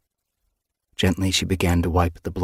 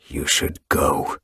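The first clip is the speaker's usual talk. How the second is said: slow, lower whispered growl; 4 semitones lower, slower than usual. A narrator's reading. Grieving leader tells her to leave, low slow growl. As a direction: growl it low and slowly under the breath. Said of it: "he growled".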